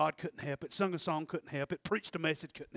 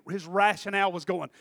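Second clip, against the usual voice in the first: usual speaking voice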